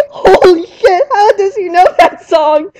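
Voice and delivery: mock terrified shriek. The trainer realizing the monster knows her lullaby, shrieking. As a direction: squeal it in hammed-up terror. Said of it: "and I thought".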